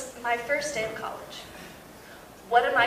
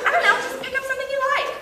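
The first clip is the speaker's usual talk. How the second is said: imitating mom; 12 semitones higher, much faster than usual. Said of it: "She's like".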